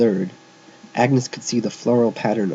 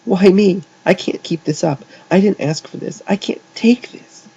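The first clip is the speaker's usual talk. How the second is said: high-pitched, frantic outburst; screamed; 9 semitones higher, 4 dB louder than usual. A narrator's reading. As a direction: scream it, at breaking point. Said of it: "she screamed".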